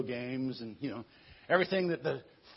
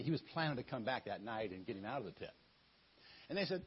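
usual speaking voice

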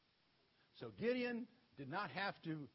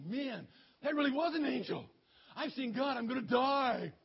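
scared to death; 4 semitones higher, 6 dB louder than usual. Acted. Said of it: "Now he's scared to death".

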